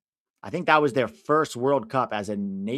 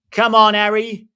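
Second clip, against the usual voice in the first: loud, higher plea; shouting encouragement; 8 semitones higher, 10 dB louder than usual. Real, not acted. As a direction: yell it at the TV. Urging Kane before penalty.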